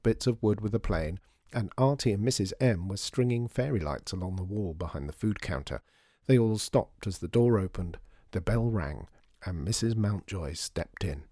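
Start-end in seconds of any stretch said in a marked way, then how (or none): none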